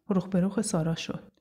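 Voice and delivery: usual speaking voice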